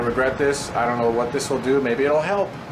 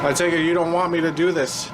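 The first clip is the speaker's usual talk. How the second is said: usual speaking voice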